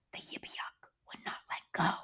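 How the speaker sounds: soft, low whisper; breathy storytime whisper. Acted. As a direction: hush it like a secret. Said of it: "I whispered to him soft and low".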